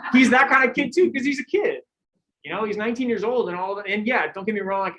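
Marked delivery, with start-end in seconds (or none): 0.1-1.8 laughing through the words, pitched up. Real, not acted. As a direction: chuckle it out fondly. Fond laugh about the rider.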